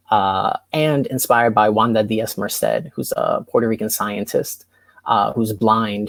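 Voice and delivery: usual speaking voice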